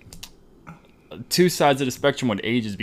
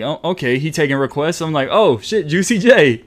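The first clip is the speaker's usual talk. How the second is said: delighted recognition; excited; 4 semitones higher, 6 dB louder than usual. Retold or imitated. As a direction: hype up at the song. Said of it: "so I'm like".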